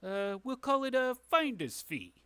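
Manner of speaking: sly fixer sweetening a deal; shady dealmaker. Acted. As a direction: wheedle like a crooked businessman. Game master voices shady dealmaker.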